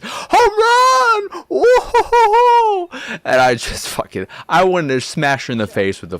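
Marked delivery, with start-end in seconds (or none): 0.0-1.4 squealed high, loud "home run"; high shrieking mock cheer. Mocking Chloe's cheer. 1.5-3.2 mock laugh.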